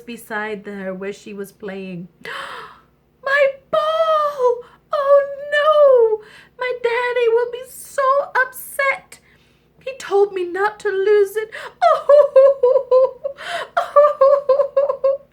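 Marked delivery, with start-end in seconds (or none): 2.1-2.7 sharp intake of breath; short, dismayed gasp; shocked gasp. Acted. Princess sees ball fall in. 3.2-15.2 high, loud hammed-up wailing; mock sobs of a distraught child; crying princess. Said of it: "And she cried and she cried".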